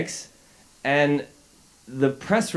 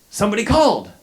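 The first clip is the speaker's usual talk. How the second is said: excited; 11 semitones higher, faster than usual. Retold or imitated. Teacher amazed someone finally called.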